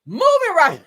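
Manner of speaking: high, loud announcer-style shout; mock announcer voice. Mocking a presenter's big delivery, loud hype voice. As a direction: belt it like a hype TV host. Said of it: "Ain't that how you sound?".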